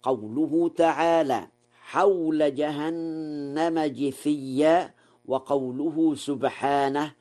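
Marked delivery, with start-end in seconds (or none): 1.8-4.9 جثيا said jithiyya, slowly; measured recitation, جثيا with i after the jim; kasra on jim. Shown as a demonstration. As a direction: begin جثيا with a "ji" sound. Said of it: "والقراءة بكسر الجيم على الإتباع".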